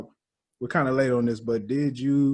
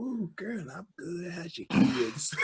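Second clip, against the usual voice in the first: high, drawn-out grandmama small talk; mock grandmamas; 8 semitones higher, 4 dB quieter, much slower than usual. Imitating two grandmamas catching up before a song, high and slow. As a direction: imitate chatty old ladies, high and slow. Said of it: "nothing but grandmamas".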